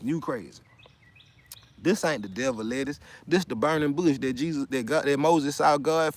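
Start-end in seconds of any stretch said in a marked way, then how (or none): none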